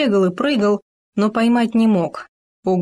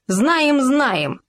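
pompous; 7 semitones higher than usual. Acted. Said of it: "важно сказали Хома и суслик".

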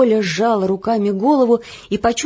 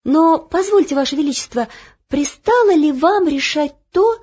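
higher, polite correction; lightly voiced page girl; 8 semitones higher, 3 dB louder than usual. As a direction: Olya correcting the king politely. Page Olya objecting. (вежливо)

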